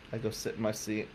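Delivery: normal talking voice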